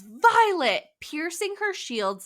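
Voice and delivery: high, loud cry of her name; acting out Xaden's urgent yell for Violet. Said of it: "Xaden yells".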